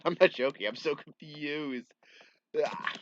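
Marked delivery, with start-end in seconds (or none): none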